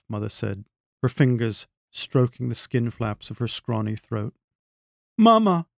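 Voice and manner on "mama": high, loud cry of Mama. A narrator's reading. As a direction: call out to her as if summoning. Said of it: "Franny cried".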